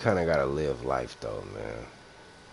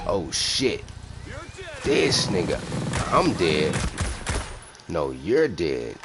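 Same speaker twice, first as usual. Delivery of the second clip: excited; 4 semitones higher, 7 dB louder, slower than usual. Real, not acted. Laughing surprise at game.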